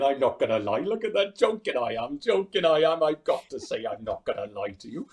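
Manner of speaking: put-on lilting Welsh accent, pitch swinging; playful Welsh comedian impression; Rob Brydon. Impressionist showing how a regional accent helps, lilting Welsh. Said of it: "or a Welsh accent, like Rob Brydon".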